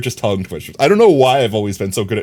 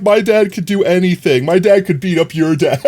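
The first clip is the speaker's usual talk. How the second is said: put-on bragging kid; 6 semitones higher, 4 dB louder than usual. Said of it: "You're supposed to be like".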